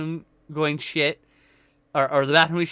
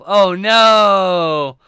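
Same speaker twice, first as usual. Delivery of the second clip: loud, higher cry of sympathy; loud dismay; 4 semitones higher, 11 dB louder than usual. Real, not acted. Streamer crying out over Raptor's story. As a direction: wail it for the viewer.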